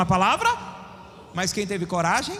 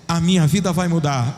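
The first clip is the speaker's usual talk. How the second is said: louder repeat; 6 semitones lower, 8 dB louder than usual. Shown as a demonstration. Preacher repeating the woman's words, louder. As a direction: say it again, louder. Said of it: "Vou de novo".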